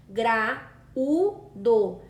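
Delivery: "Gra, u, do" as three separate beats; syllables split. Shown as a demonstration. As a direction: tap out "Gra, u, do" one syllable at a time. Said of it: "Basta separar as sílabas".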